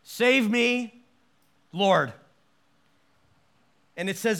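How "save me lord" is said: louder, urgent cry; a desperate call from the water; loud plea. Shown as a demonstration. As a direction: cry out Save me, Lord. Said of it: "I can't think of a more succinct prayer".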